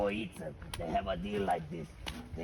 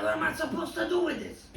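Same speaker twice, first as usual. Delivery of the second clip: much higher, fast squeaky voice with a laugh; squeaky confused prostitute; 3 dB louder, faster than usual. Acted. Confused prostitute asking the pig, squeaky and much higher. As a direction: squeak out the question in mock confusion.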